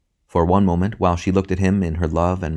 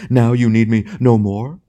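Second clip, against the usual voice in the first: slow, raised, loud lament; theatrical despair; 5 semitones higher, 6 dB louder than usual. A narrator's reading. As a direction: ham tragedian's stricken question. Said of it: "with a gesture of despair".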